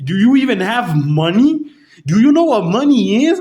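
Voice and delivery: high, loud, condescending put-on voice; mocking clerk. Clerk scoffing at a customer with no money, high mocking voice. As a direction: talk down to him like he's clueless.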